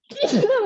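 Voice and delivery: a sudden sharp sneeze; real sneeze that breaks the sentence; sneezing. Real, not acted. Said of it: "sorry, I'm gonna sneeze".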